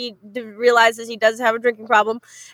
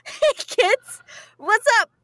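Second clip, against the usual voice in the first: put-on high-pitched hello to kids; mock cheery greeting; 9 semitones higher, slower than usual. Nanny greeting the kids inside, mock high-pitched hello. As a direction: greet children in a raised pitch.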